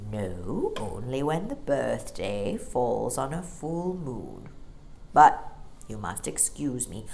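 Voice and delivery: low, slow bear voice; deep bear voice. A narrator's reading. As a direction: rumble it like a big old bear. Bear's voice in story.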